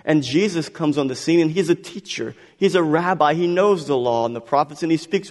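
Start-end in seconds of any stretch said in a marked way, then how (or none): none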